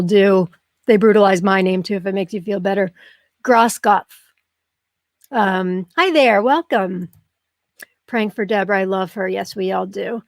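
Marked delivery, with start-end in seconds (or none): none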